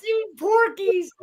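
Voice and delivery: high, squealing laughter through the words; cracking up. Real, not acted. Telling friends about the Porky's scene, cracking up. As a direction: barely get it out for laughing.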